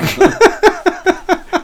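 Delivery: high burst of disbelieving laughter; incredulous laugh. Real, not acted. Laughing at the boss's shocking remark, high disbelieving laugh.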